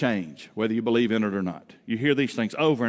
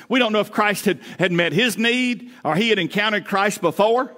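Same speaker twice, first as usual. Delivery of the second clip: higher, louder pulpit preaching, driving each phrase; preaching at full force; 8 semitones higher, 5 dB louder than usual. Acted. Pastor preaching to the daddies in the pews, fired-up and loud. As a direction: preach it out to the congregation with full conviction.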